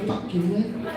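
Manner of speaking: ordinary talk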